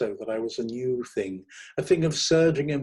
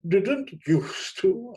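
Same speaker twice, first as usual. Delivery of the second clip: very slow, high, louder repeat; slowly; 8 semitones higher, 6 dB louder, much slower than usual. A narrator's reading. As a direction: drag each word out theatrically. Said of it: "I said slowly".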